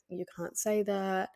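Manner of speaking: usual speaking voice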